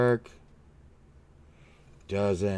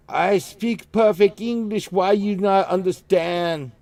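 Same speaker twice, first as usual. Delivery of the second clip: slow, high, loud German English; mock German accent, slow and loud; imitating German accent; 8 semitones higher, 7 dB louder, slower than usual. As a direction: mimic an offended German colleague. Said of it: "they were like".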